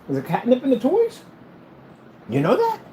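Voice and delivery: very high, rising questions; pet talk. Real, not acted. Owner quizzing pet about new toys, pet talk.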